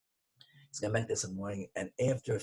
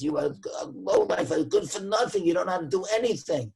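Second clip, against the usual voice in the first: a bit higher, contemptuous put-down; insulting; 8 semitones higher than usual. Retold or imitated. Customer insulting the clerk, contemptuous. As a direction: sneer at him as worthless. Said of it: "He, he mamish lost himself".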